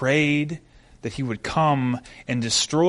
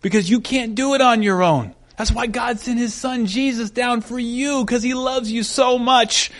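consoling; 6 semitones higher than usual. Retold or imitated. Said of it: "I finally console her".

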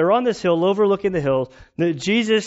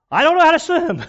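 panicked cry; 12 semitones higher, 6 dB louder than usual. Retold or imitated. Drowning swimmer calls out.

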